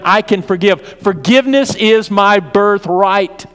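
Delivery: loud, high, ringing sermon climax; a pastor driving home forgiveness; full-force preaching. Real, not acted. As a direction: preach it out to the pews.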